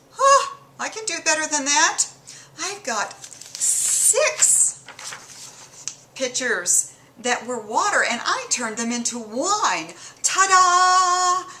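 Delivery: high, boastful show-off voice; a smug one-upper crowing about his miracle; mocking Jesus. Jesus bragging he beat Dionysus, high and smug.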